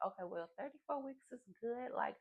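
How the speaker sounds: high, soft reassurance, imitating a nurse; calming nurse. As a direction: play the nurse soothing a worried patient. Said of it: "calmed me down".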